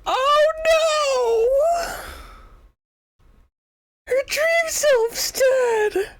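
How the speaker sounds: hammed-up high crying wail; dramatic mock cry of grief. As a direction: wail it like a stage mourner. Reacting to character death.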